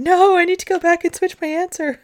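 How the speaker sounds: high, loud imitated outcry; mock protest. Family wanting to change their quiz answer, high mock protest. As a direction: imitate a whole family backtracking in alarm.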